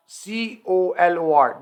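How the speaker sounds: letters spelt out one by one; slow spelling out. Shown as a demonstration. Teacher slowly spelling colour for students. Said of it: "तो spelling में देखें जो American है कलर".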